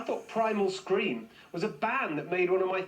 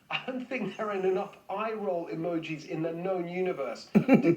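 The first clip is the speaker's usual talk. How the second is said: ordinary talk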